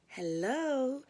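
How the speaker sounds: sweet slow receptionist coo. A narrator's reading. Narrator answers the next caller, sweet slow coo. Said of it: "I cooed".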